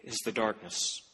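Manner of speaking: low, grave reading of the verse; solemn scripture reading. Acted. Preacher reading scripture to the congregation, solemn. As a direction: let the word darkness hang heavy.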